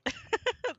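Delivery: high, real burst of giggles; spontaneous high laugh of disbelief; amused laugh. Real, not acted. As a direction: crack up mid-sentence. Cracks up at sudden realization.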